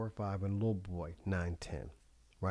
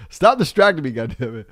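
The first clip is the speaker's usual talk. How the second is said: retold yell; 5 semitones higher, 13 dB louder than usual. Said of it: "somebody said".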